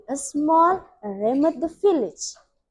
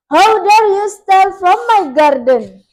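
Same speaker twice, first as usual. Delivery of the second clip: loud, higher-pitched outraged challenge; furious storybook witch, raised voice; angry witch shout; 5 semitones higher, 8 dB louder than usual. A narrator's reading. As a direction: shout it at thieves caught in the act. Witch catches thieves in garden.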